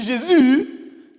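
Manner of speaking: JÉSUS cried high and long; loud church cry. Shown as a demonstration. Teacher contrasting ways of saying Jésus, high long shout. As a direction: shout Jésus with a drawn-out last vowel. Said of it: "c'est pas pareil".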